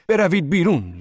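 high, loud desperate yell; urgent shout. A narrator's reading. Zorba ordering workers out of collapsing tunnel, shouting. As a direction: drive them out with a shout. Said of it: "زوربا بار دیگر فریاد کشید".

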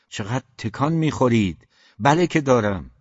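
scold, then admit it emphatically; exasperated exclamation. A narrator's reading. Scolds fidgeting, then blurts admission. (با تشر و تأکید، بلندتر)

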